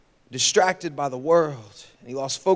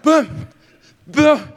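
higher, louder bump noises; 8 semitones higher, 5 dB louder than usual. Retold or imitated. Kid bumping the float from underneath, boom noises. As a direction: thud against the float. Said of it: "I'm just like".

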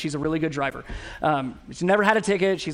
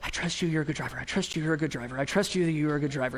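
soft, meek reassurance; husband soothing his wife, softly and meekly; sheepish soothing; 3 semitones lower, 7 dB quieter, faster than usual. Retold or imitated. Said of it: "I was like".

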